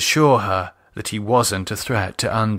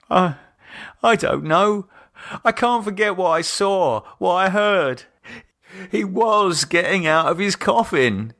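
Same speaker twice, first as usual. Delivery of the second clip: higher, unsettled and shaken; 8 semitones higher than usual. A narrator's reading. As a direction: voice lifted by lingering dread. Shaken David recalls the horror.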